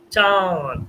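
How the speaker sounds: long nasal aa in চাঁদ; nasalised vowel. Shown as a demonstration. Teacher showing students the chandrabindu, nasalised vowel. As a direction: draw out the vowel of চাঁদ through the nose. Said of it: "নাসিকার সাথে চন্দ্রবিন্দু মিলছে".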